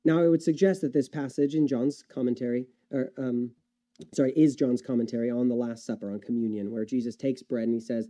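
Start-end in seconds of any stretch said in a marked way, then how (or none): none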